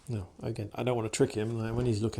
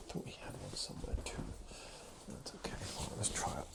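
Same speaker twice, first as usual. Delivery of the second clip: whisper; 12 dB quieter than usual. Real, not acted. Quiet aside near dog.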